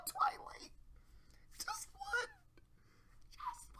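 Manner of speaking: sudden hushed high whisper after the yell; mocking whisper. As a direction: drop to a sarcastic whisper.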